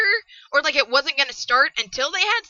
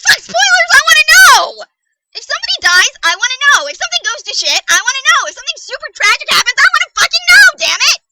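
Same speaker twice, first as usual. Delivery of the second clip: high-pitched, loud yelling rant; furious outburst; 10 semitones higher, 7 dB louder, faster than usual. Real, not acted. Reader demanding to be warned about tragedy, furious yelling. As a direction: yell each demand, voice shooting way up.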